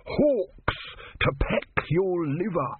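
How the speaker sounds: high, slow, strange voice; cursing tortoise god. Acted. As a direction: hurl the curse up from the basket. Om cursing from basket.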